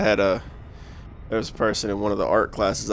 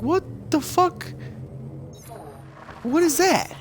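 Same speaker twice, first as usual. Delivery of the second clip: incredulous. Retold or imitated. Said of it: "I'm like".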